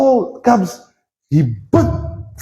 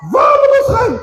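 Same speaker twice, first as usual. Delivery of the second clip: high desperate shout; high pleading shout to the Lord; desperate cry. Acted. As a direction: cry out as if abandoned. Peter's desperate cry acted.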